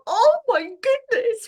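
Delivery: high, drawn-out "oh my goodness"; slow, high astonished exclamation; amazed gasp. Retold or imitated. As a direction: let the wonder lift your voice way up. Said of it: "it made me go".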